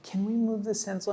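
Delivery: hushed, apologetic little whisper; sheepish whispered sorry; timid whisper. Retold or imitated. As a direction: whisper the apology like a nervous client. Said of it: "and they're like".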